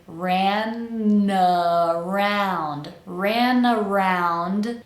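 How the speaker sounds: "ran around" slowly, N gliding into the next vowel; connected words. Shown as a demonstration. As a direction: link RAN into AROUND with no break, stretched out. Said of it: "make sure we're connecting".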